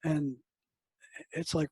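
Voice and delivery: ordinary delivery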